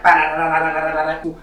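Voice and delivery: rapid-fire "bah-da-da-da" blows; imitated punch flurry. Storyteller bombs on this cat, imitated flurry of punches.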